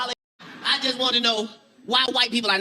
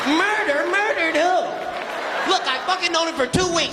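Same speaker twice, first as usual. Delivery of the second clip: indignant friend; 6 semitones higher than usual. Acted. Acting out loyal friend's outrage.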